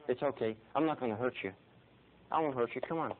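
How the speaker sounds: soft reassurance to a frightened dog; gentle coaxing. Retold or imitated. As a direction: soothe a wary dog, keeping it hushed.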